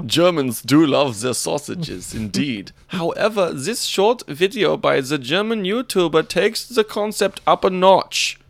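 excitable mock German accent. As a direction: proclaim it as a cartoon German. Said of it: "but with an accent".

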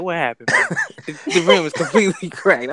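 laughing quote. Retold or imitated. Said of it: "I'm like".

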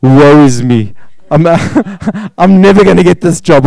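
lightly hammed-up hopeless candidate; a hint of mock woe, half laughing; mock despair. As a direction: moan it like you've already lost. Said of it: "and you're like".

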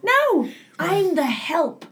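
slow, high, drawn-out comic realisation; mock dismay. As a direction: land it slowly as a mock-tragic confession. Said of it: "I was like".

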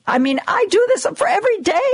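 normal talking voice

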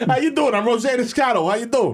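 singsong cartoon New Yorker; New York accent. Politician introducing himself, broad New York accent. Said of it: "us doing a New York accent".

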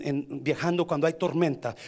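usual speaking voice

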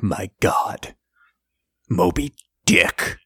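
slow, hushed whisper of awe; awed whisper. Retold or imitated. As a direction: breathe it out, stunned. Awed line in retold movie scene.

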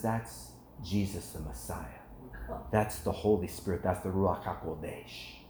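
low, soft retold reassurance; gentle witness. Wife answering the tearful seller about what he feels, gently. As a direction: imitate a wife quietly explaining her faith.

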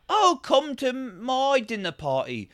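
slow, high-pitched mock invitation; mock dinner-party host. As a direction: invite in a drawn-out, put-on high voice. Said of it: "It was a lot of just like".

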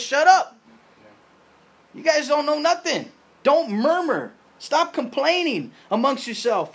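high, loud scolding shout; loud rebuke. Retold or imitated. Preacher voicing Jesus rebuking the grumblers, loud and high. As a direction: tell them off hard, like a fed-up teacher. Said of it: "Basically, he says".

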